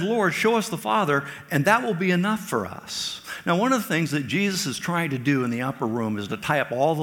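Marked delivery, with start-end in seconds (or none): 0.0-3.2 higher, louder plea; a raised, asking voice; pleading Philip. Acted. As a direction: ask it like a puzzled disciple. Philip's plea read aloud.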